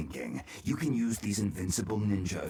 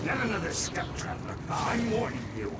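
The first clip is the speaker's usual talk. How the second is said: menacing; 8 semitones higher than usual. Acted. Villain threatens the traveler.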